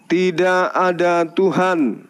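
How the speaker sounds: pelan dan jelas, tiap kata dipisah untuk ditirukan; slow dictation. Shown as a demonstration. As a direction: ucapkan perlahan agar mudah diikuti. (imam menuntun mualaf mengucap syahadat, pelan dan jelas)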